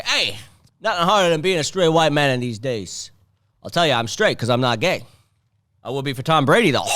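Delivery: loud mock Boston comic; hammed-up Boston bro. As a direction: ham it up like a Masshole comic. Comedian's stage persona.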